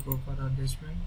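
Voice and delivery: usual speaking voice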